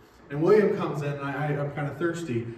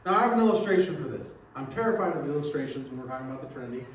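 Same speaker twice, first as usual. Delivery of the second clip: ordinary talk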